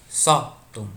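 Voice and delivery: SÓ in "sótão" punched loud, then a short break; "sótão" broken up with a strong SÓ; stress on first syllable. Shown as a demonstration. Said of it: "mais tônica".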